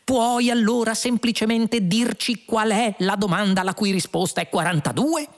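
high-pitched, insistent question; exasperated demand. A narrator's reading. Lunqual demands the question from the computer, exasperated.

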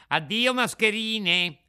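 high, loud, drawn-out farewell; scornful puppet. Acted. Puppet sends off two swindlers, mocking. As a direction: dismiss them with a ringing taunt. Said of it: "rispose il burattino".

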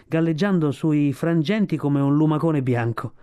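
usual speaking voice

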